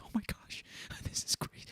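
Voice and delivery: imitating a giddy prankster under the breath; hushed mock-thrilled whisper; excited whisper. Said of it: "like, excited, like, quiet face".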